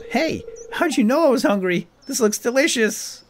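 put-on high, bright girlish voice; Abigail voice. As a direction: voice the girl delighted by a snack. Voicing Abigail eating flower.

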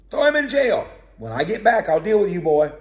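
king roaring that he'll deal with him; furious threat. Acted. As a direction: bellow at the prophet to lock him up. Retelling Ahab's threat loudly.

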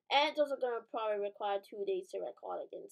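ordinary talk, nothing marked

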